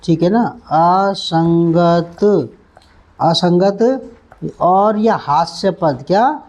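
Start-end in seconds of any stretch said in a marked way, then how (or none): 1.1-4.1 drawn out slowly; slow drill. Shown as a demonstration. Teacher repeating the Hindi meaning slowly. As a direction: hold each syllable. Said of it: "repeated slowly".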